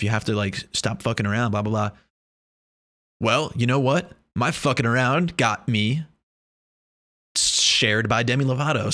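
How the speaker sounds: ordinary talk, nothing marked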